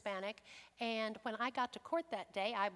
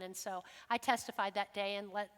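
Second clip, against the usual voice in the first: normal talking voice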